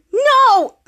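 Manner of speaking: high, loud cry of loss; high despairing shout; anguished no. Acted. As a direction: wail it as the banana is bitten. Banana taken away.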